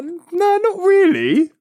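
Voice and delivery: high, bemused mock reply; own reply retold. Replying to a coworker's silly question, high deadpan. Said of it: "I was like".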